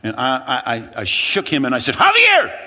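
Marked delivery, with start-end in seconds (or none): 2.0-2.6 a frantic shout of his friend's name, much higher and louder; panicked yell. Retold or imitated. Grabbing his friend by the shirt, yelling his name in panic. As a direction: yell the name while shaking him.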